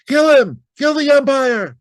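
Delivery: high, loud furious yell; angry shout. Acted. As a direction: scream it from the stands. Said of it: "shouted someone on the stand".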